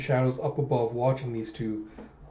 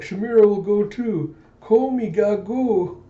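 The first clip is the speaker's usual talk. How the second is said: high, loud, sing-out character voice; eager high-pitched squeal of a voice; Shamira; 8 semitones higher, 6 dB louder than usual. Acted. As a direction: pipe up high and loud, drawing out the end. Acted childlike character line.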